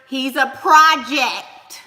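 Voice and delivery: "project" loud and stretched out; emphatic punchline. Real, not acted. Streamer judges a man on twenty thousand yearly, punching "project". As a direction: land hard and slow on "project".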